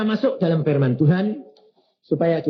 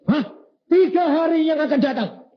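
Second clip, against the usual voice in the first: high, startled question; astonished cry of disbelief; 12 semitones higher than usual. Retold or imitated. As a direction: hit the top of the voice in shock. Shocked reply to God in story.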